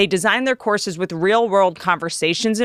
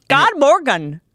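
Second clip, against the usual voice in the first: Swedish greeting; 4 semitones higher, slower than usual. Shown as a demonstration. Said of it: "That's good morning".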